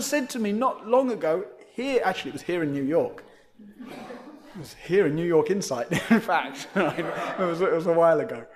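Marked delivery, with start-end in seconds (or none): 3.6-8.5 laughing. Real, not acted. Laughter heard.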